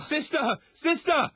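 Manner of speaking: high, urgent shout for the nurse; calling out in alarm. Acted. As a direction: yell for help, panicked.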